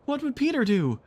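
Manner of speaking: put-on high urgent boys' cry. A narrator's reading. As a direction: call out for an answer. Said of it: "they cried simultaneously".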